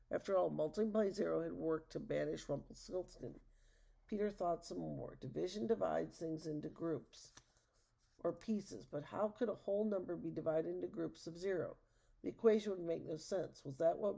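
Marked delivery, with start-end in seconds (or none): none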